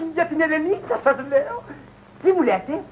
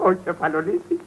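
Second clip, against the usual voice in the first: low-pitched, softer utterance; low first mention; 10 semitones lower, 5 dB quieter than usual. Acted. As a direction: keep Κεφαλονίτη down low and subdued. First call, low, then repeated high. (χαμηλόφωνα, σε χαμηλό τόνο)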